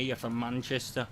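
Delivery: a light Manchester accent; lightly voiced Manchester accent. Shown as a demonstration. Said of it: "bring it down here".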